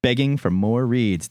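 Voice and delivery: high-pitched segment intro, hammed up; over-the-top show host; hype announcer. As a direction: hype up the listener-mail segment. Hyped introduction of the mail segment.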